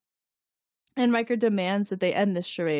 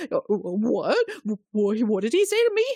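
flabbergasted; 2 semitones higher, 3 dB louder than usual. Retold or imitated. Host voices Picard stunned by Riker's shut up, flabbergasted. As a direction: sputter in mock disbelief, pitch climbing at the end.

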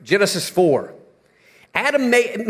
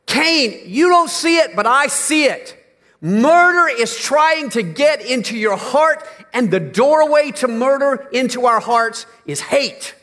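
high, raised preaching voice as God; God warning Cain; 5 semitones higher than usual. Acted. Preacher voicing God warning Cain, raised and urgent. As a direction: call out to Cain like a warning from above.

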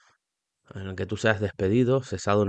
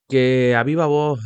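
loud, slow, like a referee; referee call; 4 semitones higher, 6 dB louder, slower than usual. Real, not acted. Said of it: "me parezco árbitro ahora".

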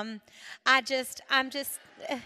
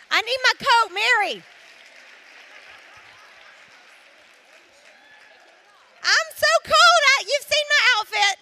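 much higher and louder, shivering excitement; cold and excited; 10 semitones higher, 12 dB louder than usual. Real, not acted. Freezing speaker begging a friend for her coat, loud and high. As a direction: squeal that you're freezing.

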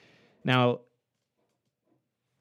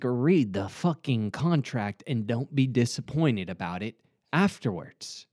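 normal talking voice

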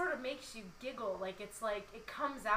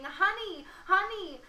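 high shouted calls across the harbor; screaming; 10 semitones higher, 11 dB louder than usual. Acted. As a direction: scream her pet name from far away. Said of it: "You're screaming".

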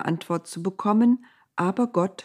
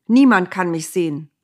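louder, sharp rebuke; snapping angrily; 7 dB louder than usual. A narrator's reading. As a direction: bite back at him. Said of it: "fuhr Gott ihn an".

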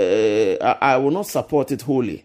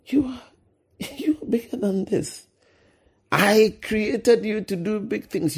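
higher, coaxing voice of encouragement; encouraging inner voice; 7 semitones higher than usual. Retold or imitated. Voice of conviction calling a fallen pastor upward, higher and coaxing. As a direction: lift the listener up, gently urging. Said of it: "The second voice that you hear that says".

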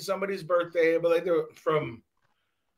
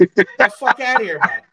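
mock Canadian accent; 3 semitones higher, 8 dB louder than usual. Telling the man to get out of here, mock Canadian accent. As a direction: shoo him off like a cartoon Canadian. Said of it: "on some Canadian shit".

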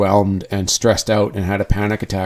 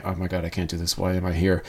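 hushed panicky thoughts; 4 semitones lower, 8 dB quieter, faster than usual. Retold or imitated. Panicked inner voice at event door.

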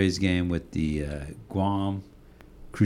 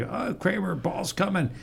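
warning call; 12 semitones higher, 5 dB quieter than usual. Retold or imitated.

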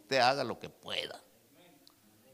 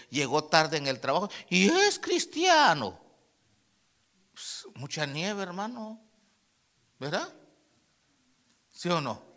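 ordinary talk

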